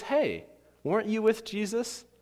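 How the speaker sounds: put-on high-pitched little girl voice; put-on high voice of a servant girl. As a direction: pipe up like a curious child. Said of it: "this little girl comes running out".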